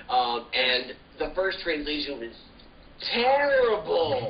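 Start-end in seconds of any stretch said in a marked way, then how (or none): 3.0-4.3 drawn-out, high disgusted "terrible"; drawn-out disgusted groan of a word. Real, not acted. Disgusted verdict on translation.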